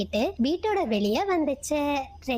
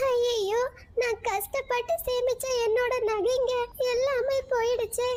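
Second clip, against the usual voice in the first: crying out; 10 semitones higher than usual. Acted. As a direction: cry it out in despair. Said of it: "மீனுக்குருவி அழுத சத்தத்தை".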